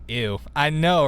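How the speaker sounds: usual speaking voice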